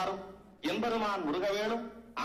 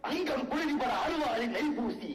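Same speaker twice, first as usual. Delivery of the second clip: higher-pitched, declaimed poem with fierce scorn; taunting verse recital; 3 semitones higher than usual. Acted. Rival poets trade verse insults in court, declaiming scornfully.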